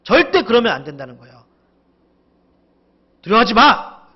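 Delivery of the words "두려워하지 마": loud, raised, abrupt command; stern scolding. Acted. The Lord commanding his people not to fear, stern. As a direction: order it sternly, as a reprimand. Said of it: "약간 꾸짖는".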